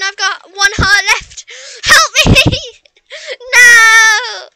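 high, loud half-laughing wail; panicked crying out. Real, not acted. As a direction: cry out for help in panic. Nearly dying to dragon.

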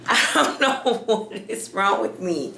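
helpless giggle breaking into the words; laughing. Real, not acted. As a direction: laugh at yourself while talking. Cracks up at own goofy voice.

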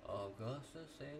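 very quiet trial run of an accent; softly testing a character voice; voice warm-up. Shown as a demonstration. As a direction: try the name under your breath. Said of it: "let me warm up real quick".

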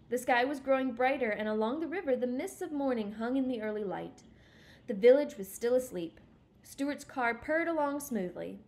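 lively, emphatic read-aloud; story narration. A narrator's reading. Reader telling listeners of Stuart heading north, lively narration. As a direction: tell it like a journey setting off.

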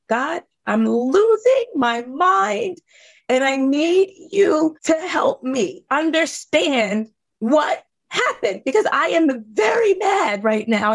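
high, slow, breaking cry to God; desperate plea to God. Retold or imitated. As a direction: plead with God, voice cracking up high, then flaring into anger. Crying out to God.

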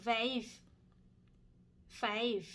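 vijf once with w, then with f; Dutch vijf. Shown as a demonstration. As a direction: say vijf two accepted ways. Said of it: "ได้หมดทั้งสองนะคะ". (ออกเสียง vijf ได้ทั้งสองแบบ)

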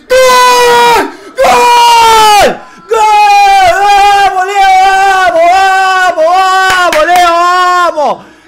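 high, full-throated scream of joy; goal celebration. Real, not acted. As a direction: scream the goal, stretching each cry. Festeja un gol en vivo. (festejo de gol a grito pelado)

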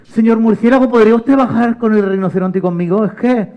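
put-on slightly high polite child voice; pleading little girl. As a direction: open with a high cry, then ask nicely. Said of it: "María lo vio y le dijo".

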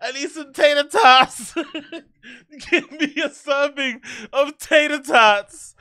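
high, slow words stretched through laughter; laughing. Real, not acted. Mocking a fan's order, laughing hard. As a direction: giggle out the order.

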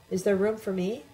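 ordinary delivery

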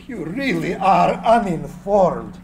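ordinary delivery

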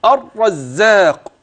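"الرزاق" slowly, with the doubled z held firmly; drawn-out "الرزاق" with a heavy double z. Shown as a demonstration. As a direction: lean on the shadda of "الرزاق". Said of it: "وخلي بالك من المشدد".

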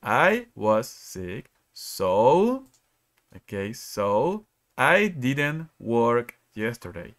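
slow, careful example sentence; slow model reading. Shown as a demonstration. Teacher modelling the correct answer to the class, slowly. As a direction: stretch the example out for learners. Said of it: "we say".

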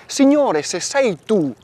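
"Signore" cried out high and loud, then "se sei tu" pressing; Peter's plea. Acted. Priest voicing Peter calling Jesus on the water, urgent loud plea.

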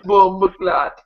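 a mock Jamaican exclamation; Jamaican accent. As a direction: toss it off in a joking Jamaican accent. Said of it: "I'll try that in Jamaican".

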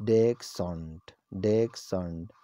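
ڈیک سونٹ said as DEK-sunt; dachshund, UK. Shown as a demonstration. Teacher models the UK dachshund for viewers. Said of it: "اور U S میں ہے".

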